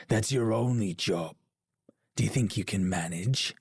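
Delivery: ordinary talk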